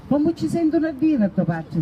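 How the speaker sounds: Sicilian dialect. Retold or imitated. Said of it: "si diceva".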